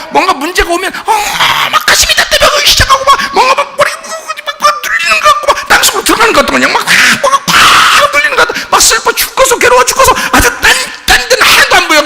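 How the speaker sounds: very high, strained anguished wail; exaggerated overwhelmed distress; hammed-up panic. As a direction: spiral breathlessly through every torment. Acting out panic when troubles come.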